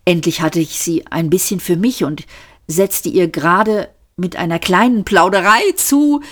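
ordinary delivery, nothing marked

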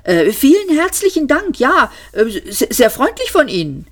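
high-pitched, stammering politeness; flustered thanks. A narrator's reading. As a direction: stumble through thanks, at a total loss. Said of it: "überhaupt keine Ahnung mehr hatte, wie er sich verhalten sollte".

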